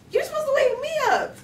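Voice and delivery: very high, loud complaint; mock indignant squeal. Girl to her puppy who skipped the wake-up, squealing indignantly.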